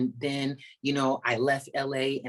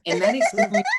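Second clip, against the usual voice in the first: louder, higher, breaking into laughter; laughing start; 10 semitones higher, 8 dB louder than usual. Real, not acted. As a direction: start the phrase mid-laugh. Laughs then restarts sentence.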